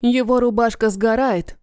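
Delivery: ordinary delivery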